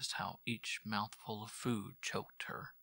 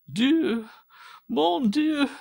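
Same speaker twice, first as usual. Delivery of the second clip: much higher and louder calling on God; anguished outcry; 15 dB louder, much slower than usual. Acted. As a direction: cry out to God in despair. Mother calls on God.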